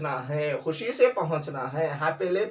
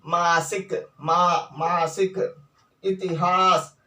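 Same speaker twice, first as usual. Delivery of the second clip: ordinary talk, nothing marked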